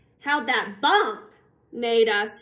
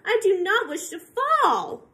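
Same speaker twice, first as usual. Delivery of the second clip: mock fearful fish; 9 semitones higher, 5 dB louder than usual. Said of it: "said the fish".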